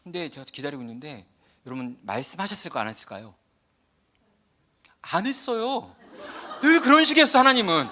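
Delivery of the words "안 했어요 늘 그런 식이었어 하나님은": laughing, much louder and higher on the complaint; laughing exasperation. Real, not acted. Telling the congregation God stayed silent, laughing loudly. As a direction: laugh it out in mock frustration. Punchline about God's silence.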